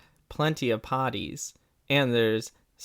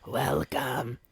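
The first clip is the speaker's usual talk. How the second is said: spooky villain voice; much slower than usual. Pretend. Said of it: "Oh, there we go".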